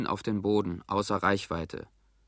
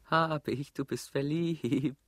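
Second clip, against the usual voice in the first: put-on teasing girl's voice; 3 semitones higher, 4 dB quieter, slower than usual. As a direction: tease him gently like a shy girl. Isabel teases him. (Isabel neckt ihn leise: du bist verliebt)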